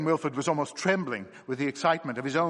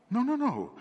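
high, weary but wild protest; exhausted insistence; wild fatigue; 12 semitones higher than usual. Acted. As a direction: wave it off, wild and worn out. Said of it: "with a sort of wild fatigue".

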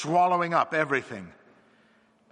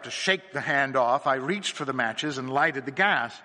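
ordinary delivery

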